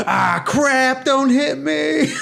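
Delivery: high, drawn-out panicked wail; mock panic. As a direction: wail in hammed-up alarm, stretching the last word. Acts out panic at oncoming karts.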